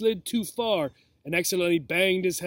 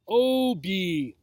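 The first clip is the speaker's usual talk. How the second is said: each syllable drawn out and savored; slowly and deliciously; 2 semitones higher than usual. A narrator's reading. Snake savors Obi's name, slow and delicious. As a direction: savor the name, stretched long. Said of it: "slowly and deliciously, pronouncing each syllable".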